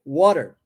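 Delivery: "Water" said slowly as wor-der, with a tapped t; English word water. Shown as a demonstration. Teacher models the word for students: "Water" said carefully, with a tapped t. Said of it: "Palavra water".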